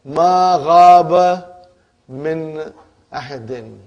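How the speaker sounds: Ma ghaba min ahadin spelled out very slowly; slow example read. Shown as a demonstration. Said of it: "example of negation".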